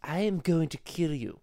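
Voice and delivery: lightly voiced evil baby; menacing Stewie. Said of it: "my friend Stewie".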